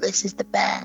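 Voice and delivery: put-on happy child.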